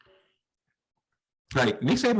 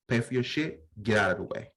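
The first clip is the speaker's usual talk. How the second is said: ordinary talk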